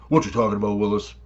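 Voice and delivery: low, quiet incredulous catchphrase; catchphrase quote. Retold or imitated. Canadians reacting to a tariff tax, low and incredulous. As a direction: drop low like the famous sitcom line. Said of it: "and Canadians be like".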